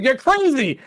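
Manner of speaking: high, loud shout of "crazy"; shouted accusation. Retold or imitated. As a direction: yell it out in disbelief. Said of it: "and he's like".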